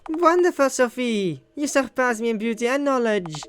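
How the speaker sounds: theatrical high-pitched flattery; gushing praise. Acted. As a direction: swoon over Sophie. Character gushes praise.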